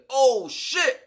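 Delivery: high, loud shocked gasp of words; high loud startled "oh shit". Retold or imitated. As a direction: react in sudden shock. Shocked discovery.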